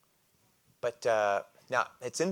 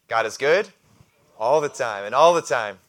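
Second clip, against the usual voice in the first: ringing, louder preacher's chant; loud call-and-response; 3 semitones higher, 13 dB louder than usual. Real, not acted. Preacher leading the congregation in the refrain, loudly. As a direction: proclaim it to the room.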